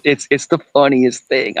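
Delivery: ordinary talk, nothing marked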